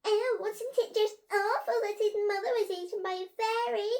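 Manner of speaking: high, loud outburst from a gossipy pupil; crying out. A narrator's reading. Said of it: "cried Frankie".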